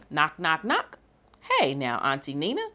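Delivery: bouncy, sing-song storybook reading; rhythmic read-aloud. Acted. Librarian reading a jazz picture book to children, bouncy and rhythmic. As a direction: read it like a jazzy chant for kids.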